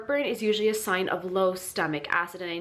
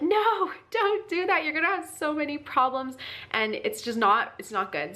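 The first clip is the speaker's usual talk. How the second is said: laughing; 7 semitones higher than usual. Retold or imitated. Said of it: "And I was like".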